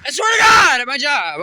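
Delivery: sudden loud, high outburst; excited shout. Real, not acted. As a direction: blurt it out at full volume. Excited rant about coworker.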